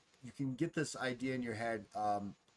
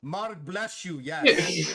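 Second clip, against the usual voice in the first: higher, emphatic creature voice; Mark; 6 semitones higher, 11 dB louder, much slower than usual. Acted. Mark sending the player off, higher emphatic blessing. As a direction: bless them in simple, heartfelt words.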